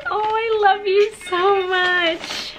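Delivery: teary, touched. Real, not acted. Moved by a friend's letter.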